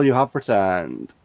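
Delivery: usual speaking voice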